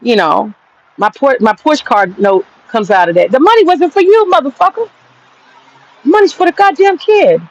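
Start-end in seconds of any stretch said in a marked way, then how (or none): 3.3-7.4 pitched way up, venting hard at the mother; fed-up rant, voice rising high; angry outburst. Real, not acted. Ranting at greedy mother.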